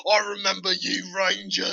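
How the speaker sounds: a lightly done cockney orc growl; cockney orc, menacing recognition. Retold or imitated. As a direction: imitate a cockney orc who recognizes you. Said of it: "they all have that cockney ass, like, accent".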